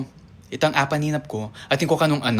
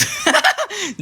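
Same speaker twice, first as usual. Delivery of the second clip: shrill burst of laughter; high laugh; 3 dB louder than usual. Real, not acted. Vlogger laughing at her own baby joke, high laugh. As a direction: crack up at your own joke.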